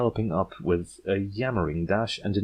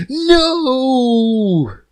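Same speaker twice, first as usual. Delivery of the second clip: much higher, much louder crying no; desperate shout; 10 dB louder than usual. Acted. Yearling leaping at the author, desperate loud cry. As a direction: hurl it as you leap.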